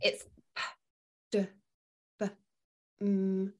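p, d, b, m as short pure sounds, no vowel after. Shown as a demonstration. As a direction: clip each letter to its bare sound. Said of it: "it's the smallest unit of sound".

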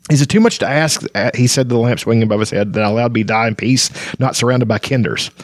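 ordinary talk

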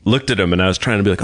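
ordinary delivery

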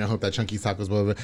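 ordinary talk

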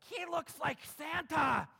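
high, slow, gleeful shout; kid's loud blurt. Acted. Child spotting a bearded man in line, shouting. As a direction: shout it out like an excited little kid.

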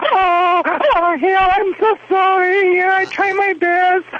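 high, drawn-out falsetto grovelling; mock pleading apology. Dog trainer phoning his boss to apologize, hammed-up high pleading. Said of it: "So I just call him and I say".

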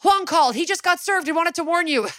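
high, hurried retold alert; urgent rushed warning. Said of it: "Liv rushes up and goes".

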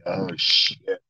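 unvoiced whisper of dread. Whispering to himself as Pacino calls his name, scared. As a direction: breathe it out like a scared kid. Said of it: "I'm like".

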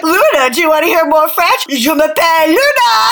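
lightly hammed-up French-speaking dad; Lee. Friend teasing a French Canadian dad showing off to his daughter, mock-fancy French. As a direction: show off your French, mock grandly. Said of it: "He's like".

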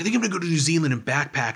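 tossing off a grand plan carelessly; manic plan. Retold or imitated. His manic plans.

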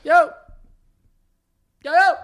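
loud, high yo; calling out. Shown as a demonstration. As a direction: call out to a friend. Shouting yo to demonstrate.

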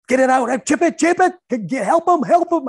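loud high bench cheering; hyped-up cheerleader shouting from the bench; cheerleader yelling. Retold or imitated. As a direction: yell encouragement from the bench. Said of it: "and I am a cheerleader".

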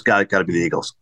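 low, understated retold reply; lightly voiced die-hard fan, dropped low; Bradley Cooper. Movie star standing by his team, low and unbudging. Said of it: "He goes".

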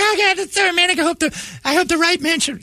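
very high squeaky swooning voice; mock gushing women. Women swoon over the wedding crasher, mockingly gushing. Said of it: "And women are like".

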